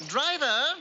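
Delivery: high, loud summons; calling out. Acted. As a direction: call sharply to the coachman. Duke calls to his driver.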